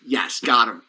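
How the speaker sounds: sly gotcha. Retold or imitated. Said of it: "And then they go".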